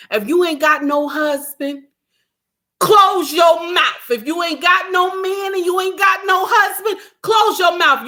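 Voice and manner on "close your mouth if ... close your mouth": shouted, slowed command to shut up; shouting down women who talk out of turn; scolding shout. Real, not acted. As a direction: bark it at the crowd.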